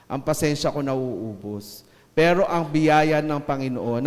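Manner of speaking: usual speaking voice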